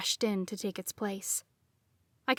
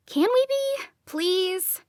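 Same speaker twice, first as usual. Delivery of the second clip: pleading; 10 semitones higher, 3 dB louder, slower than usual. A narrator's reading. Talia pleading, tired of it.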